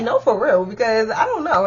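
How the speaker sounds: ordinary talk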